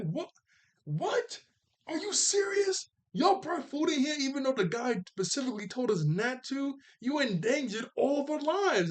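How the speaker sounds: high, squeaky hammed-up disbelief; mock outrage. Aang scolding the groups over smuggled food, mock outrage. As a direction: play Aang scandalized and scolding. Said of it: "really mad about this".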